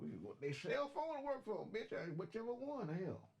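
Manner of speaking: high mock-rude assistant voice; sassy Siri. Trucker voicing a rude phone assistant, high sassy voice. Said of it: "Siri voiced rudely".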